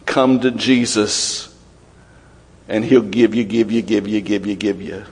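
lightly mocking, fading to a soft echo; mock the greedy sales pitch, trailing away; mocking prosperity gospel pitch. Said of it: "says something like this".

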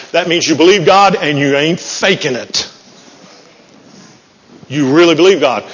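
normal talking voice

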